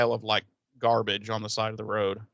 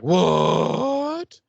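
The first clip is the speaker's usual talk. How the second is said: shocked what; 6 semitones higher, 6 dB louder than usual. Retold or imitated. Everyone shocked.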